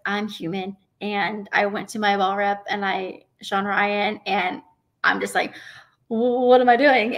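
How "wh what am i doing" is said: half-mock panicky question; panicked. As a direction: blurt it out like you're freaking out mid-match. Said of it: "And freaking out".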